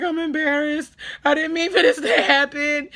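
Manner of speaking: high, loud mock-tearful wail; crying, embarrassed. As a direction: re-enact a crying, mortified apology. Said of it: "I was crying".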